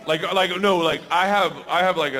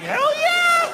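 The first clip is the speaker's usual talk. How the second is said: very high, louder hell yeah; gleeful shout; 5 dB louder than usual. Retold or imitated. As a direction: whoop it out. Excited answer about John Henry.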